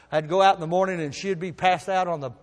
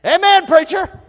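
much higher, louder congregant cry; mock amen; 12 semitones higher, 10 dB louder than usual. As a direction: shout back from the pews. Voices a congregation response.

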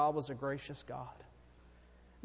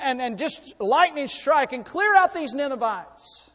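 loud, high, driving sermon delivery; full-force preaching; 7 semitones higher, 10 dB louder than usual. Real, not acted. Preacher telling the congregation of a changed city, full-force preaching. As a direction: preach it out, raised and emphatic.

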